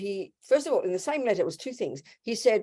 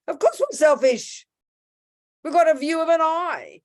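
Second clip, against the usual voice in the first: loud, high, exasperated retort; emphatic retort; 8 semitones higher, 5 dB louder than usual. Acted. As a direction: raise your voice as if stating the obvious. Emphatic retort to imagined protest.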